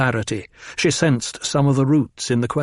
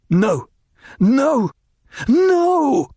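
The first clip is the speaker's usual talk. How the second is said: each No louder and higher, climbing to a scream; rising anguished cries; 13 semitones higher, 3 dB louder, much slower than usual. A narrator's reading. Paul alone in his tent, screaming No in rising anguish. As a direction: cry out in mounting panic. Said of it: "pounding the tent floor with his fists".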